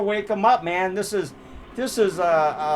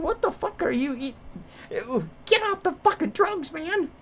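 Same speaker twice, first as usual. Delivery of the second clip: pitched way up, outraged creature ranting; Yeti voice, high and indignant; 8 semitones higher than usual. Retold or imitated. Yeti complaining to the cops who woke him, high outraged voice. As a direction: squeal it like a fed-up Yeti. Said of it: "He goes".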